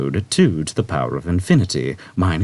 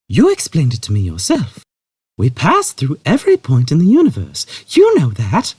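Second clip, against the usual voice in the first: higher, measured and patient; patient Trillian; 8 semitones higher, 6 dB louder than usual. A narrator's reading. Trillian patiently explaining to Zaphod, measured. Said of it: "she said patiently".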